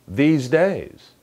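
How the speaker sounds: "these" drawn out, "days" falling; lengthened "these" before "days"; long THESE. Shown as a demonstration. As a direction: stretch the vowel in "these". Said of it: "these는 길죠".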